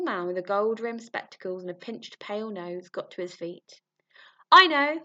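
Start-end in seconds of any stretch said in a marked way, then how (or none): 4.5-5.0 high, loud squeaky voice; squeak. Acted. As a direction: squeak it out like a weedy little man. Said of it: "he squeaked".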